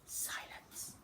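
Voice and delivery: breathy hushed single word; whisper. A narrator's reading. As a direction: let it hang in a whisper. Suspense beat.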